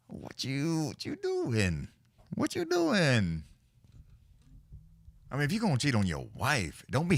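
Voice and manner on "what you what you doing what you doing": drawn-out, disbelieving what you doing; slow, high mocking scold; mock incredulous scold. Friends joking about a cheating rocker, mock disbelief.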